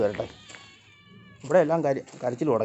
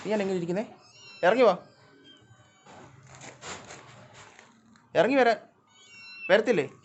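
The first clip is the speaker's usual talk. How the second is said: coaxing a cat down; 8 semitones higher than usual. Real, not acted. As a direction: coax the cat off its perch. (പൂച്ചയെ താഴെ ഇറങ്ങാൻ ഉറക്കെ വിളിക്കുന്നു)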